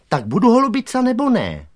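put-on very high, loud girl's voice; pushy little girl. A narrator's reading. Girl butts in to ask the gang, pushy. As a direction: butt in, demanding an answer. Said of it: "vetřela se Simona".

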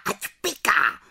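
high witch screech, stress on ať; shrill witchy jeer; witches shrieking. Acted. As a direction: screech the taunt again. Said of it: "vřískaly".

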